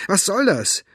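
high-pitched indignant challenge; snapping. A narrator's reading. Elinor confronting the stranger at her car window, snapping. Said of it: "fuhr sie den Fremden an".

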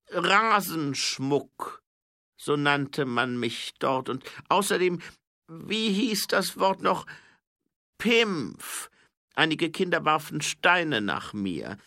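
high, loud, slow king with a grievance; gekränkter Zwergenkönig. A narrator's reading. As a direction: spell out old insults pointedly, high and loud. (hoher, lauter König, langsam und vorwurfsvoll)

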